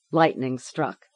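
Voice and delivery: plain reading voice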